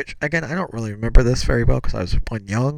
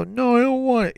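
put-on high whiny child saying no; mock-weepy high voice; imitated tearful refusal; 14 semitones higher than usual. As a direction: refuse like a sulking, crying kid. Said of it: "like, crying".

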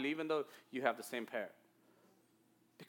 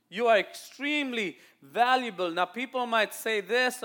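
higher, emphatic sermon delivery; full-voiced preaching; 5 semitones higher, 7 dB louder than usual. Real, not acted. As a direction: preach each key word with force. Preaches to the congregation.

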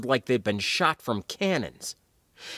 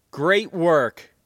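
louder, higher, beaming praise; grinning exclamation; 6 semitones higher, 5 dB louder than usual. Acted. Gunner praising both of them after the win, grinning exclamation. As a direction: exclaim it with an audible grin. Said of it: "exclaimed with a grin they could hear".

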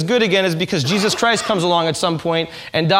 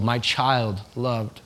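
very slow and softer, tender; tender whisper-soft; 7 semitones lower, 6 dB quieter, much slower than usual. Acted. Preacher voicing God to a beloved child, very slow and soft. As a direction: let it land gently, like a blessing. God's tender words, slowed.

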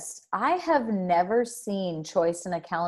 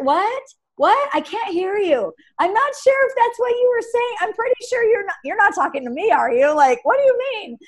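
high, loud feigned confusion; mock playing dumb; 10 semitones higher, 3 dB louder than usual. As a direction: pretend you can't hear him. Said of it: "you're like".

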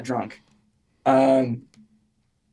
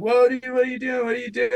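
much higher, urgent calling out, voiced as the buddies; alarmed friends calling; 10 semitones higher, faster than usual. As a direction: call after a friend bolting out the door. Buddies yelling as he runs out.

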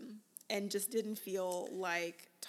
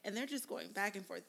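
ordinary talk

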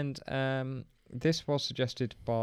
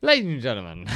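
ringmaster; 5 dB louder, faster than usual. Shown as a demonstration. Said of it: "Oh, start again".

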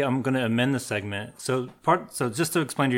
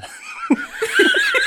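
very high squeal of laughter; 8 dB louder than usual. Real, not acted.

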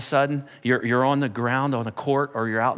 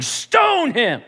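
higher, louder command; ordering shout; 8 semitones higher, 4 dB louder than usual. Acted. Saul ordering the crowd to stone Stephen, loud command. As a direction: bark it at the crowd.

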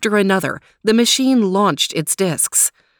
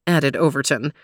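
plain reading voice

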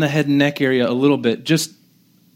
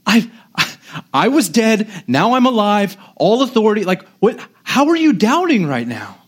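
higher-pitched, incredulous mock outburst; volatile exasperation; 6 semitones higher than usual. Preacher imagining himself as Jesus facing doubting disciples, volatile exasperation. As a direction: argue in disbelief, as if badly affronted. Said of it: "'cause I'm very volatile".

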